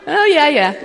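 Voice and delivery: high, loud laugh then giggling yeah. Real, not acted. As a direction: laugh freely, then catch breath.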